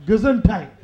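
ordinary talk, nothing marked

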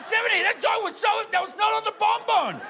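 livid scream. Retold or imitated. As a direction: imitate a furious aunt screaming across the table. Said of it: "she screams across the table".

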